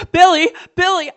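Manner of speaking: loud high panicked cry of his name; panicked scream. Retold or imitated. Screaming at brother before boom.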